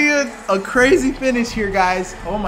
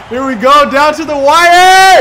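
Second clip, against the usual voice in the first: excited; 7 semitones higher, 13 dB louder than usual. Real, not acted. Game tied in final seconds.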